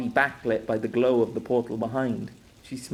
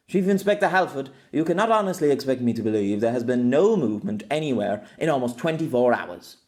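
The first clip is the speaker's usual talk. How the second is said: stern, incredulous superior; authoritative woman doctor; 4 dB louder than usual. Acted. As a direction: challenge the inspector with authority.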